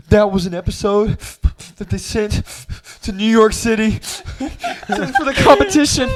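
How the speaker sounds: high, loud tearful wail, breaking into laughter; hammed-up imitation of an upset teacher crying; mock crying.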